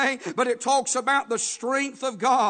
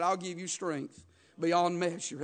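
softer, lower promise from God; tender promise; 6 semitones lower, 7 dB quieter than usual. Retold or imitated. Preacher to congregation, voicing God's promise softly. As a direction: hush it down like a gentle vow.

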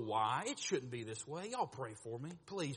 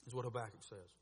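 ordinary talk, nothing marked

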